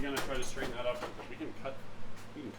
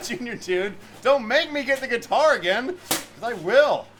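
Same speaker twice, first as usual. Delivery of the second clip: playful threat; 9 semitones higher, 10 dB louder than usual. Real, not acted.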